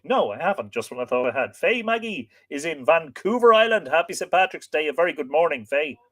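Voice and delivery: ordinary delivery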